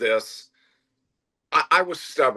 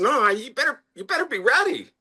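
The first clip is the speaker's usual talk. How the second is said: high-pitched, insistent retort; insistent retold warning; 12 semitones higher than usual. Owner warning skeptical staff to get ready, insistent. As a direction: warn them in a raised pitch.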